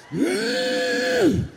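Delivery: high, strained mm of pushing hard; effort grunt. Retold or imitated. Preacher imitating himself pushing, high strained grunt. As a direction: grunt like you push with all your strength.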